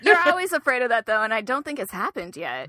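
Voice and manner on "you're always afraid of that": teasing through laughter; laughing. Real, not acted. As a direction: say it while laughing.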